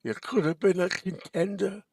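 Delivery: an ambitious first try at Brando; Marlon Brando. Retold or imitated. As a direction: go for the full Brando. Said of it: "So you do a Marlon Brando impersonation".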